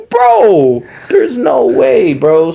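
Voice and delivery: high, drawn-out protest; exasperated disbelief. Retold or imitated. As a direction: stretch it out in pained disbelief. Acted disbelief at bystander siding against him.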